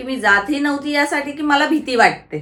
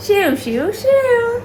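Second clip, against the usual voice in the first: playful name-calling climbing high at the end; sing-song calling; 10 semitones higher, slower than usual. Real, not acted. Aunt calls the toddler to repeat a word, sing-song calling.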